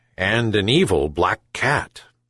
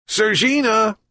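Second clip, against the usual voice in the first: high called name; calling out; 12 semitones higher than usual. A narrator's reading. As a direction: call the cat from another room. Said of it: "called the old man".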